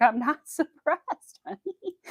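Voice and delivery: high, slow, laughing through the words; laughing tease. Retold or imitated. Teasing her husband about his Amazon habit, laughing. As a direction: tease him while giggling. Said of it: "I was like".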